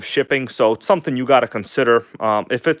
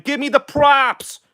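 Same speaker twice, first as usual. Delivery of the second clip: much higher, slow hammed-up brag; goofy higher voice demanding credit; silly put-on voice; 13 semitones higher, slower than usual. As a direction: stretch it out like a cartoon show-off. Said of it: "Why am I talking like that again?".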